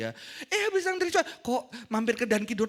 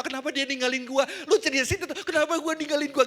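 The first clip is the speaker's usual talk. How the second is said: whiny heartbroken friend; 5 semitones higher, 8 dB louder than usual. Retold or imitated.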